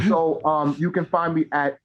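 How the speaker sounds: ordinary delivery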